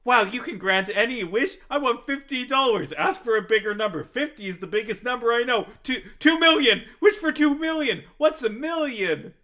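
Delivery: put-on high, loud goofy kid voice; Aladdin as a dumb kid. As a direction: play him as a clueless excited kid. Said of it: "that Aladdin is like".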